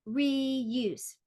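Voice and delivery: "Reuse" stretched over two beats; drawn-out syllables in "Reuse"; split into syllables. Shown as a demonstration. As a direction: say "Reuse" as re, use. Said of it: "divide words into syllables".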